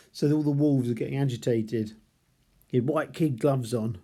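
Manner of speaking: ordinary talk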